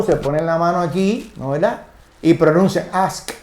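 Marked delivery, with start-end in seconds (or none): 2.9-3.3 "ask" with a crisp voiceless sk at the end. Shown as a demonstration. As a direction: demonstrate the unvibrated k closing "ask". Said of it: "las cuerdas vocales no vibran".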